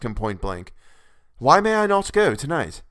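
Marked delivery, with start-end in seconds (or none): 1.4-2.8 higher, louder urgent question; urgent plea. Acted. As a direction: press him, anxious to leave. Harker pleads with the Count.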